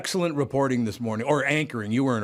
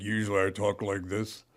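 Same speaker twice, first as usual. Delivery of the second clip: demonstrating a slightly deeper normal voice; a touch lower and softer, as a demo; usual lower voice; 3 semitones lower, 4 dB quieter than usual. Shown as a demonstration. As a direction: drop a little into your lower register. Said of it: "an octave higher than it normally is".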